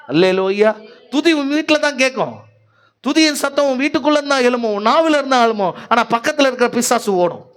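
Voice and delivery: high-pitched, urgent exhortation; rousing pulpit proclamation; fervent preaching. Real, not acted. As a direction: drive the promise home to the congregation.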